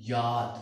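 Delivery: German J said as "याद", with a y sound; German letter name. Shown as a demonstration. Teacher naming the letter J for learners, German pronunciation "याद". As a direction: say "याद" starting with y, not English j. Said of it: "इसका तलफ़ुज़ है German language में".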